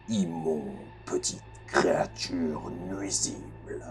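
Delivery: deep, slow, booming guardian voice; low and slow, echoing through the city; cavernous echoing voice. Acted. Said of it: "une voix caverneuse qui a l'air de résonner".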